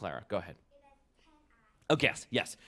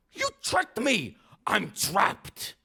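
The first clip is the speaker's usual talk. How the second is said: high, slow goblin wail; outraged goblin; 9 semitones higher, 4 dB louder, much slower than usual. Acted. Trapped goblin accusing Herschel, high outraged wail. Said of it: "said the goblin".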